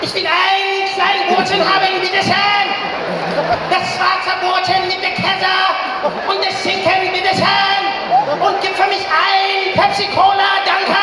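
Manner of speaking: high, shouted German dictator harangue; Hitler. Retold or imitated. Ordering a sandwich at the counter, shouted Hitler-style. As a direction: bellow it like a rally speech. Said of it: "I tend to sound like Hitler".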